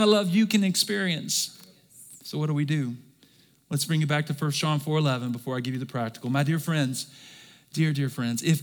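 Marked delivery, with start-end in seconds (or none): none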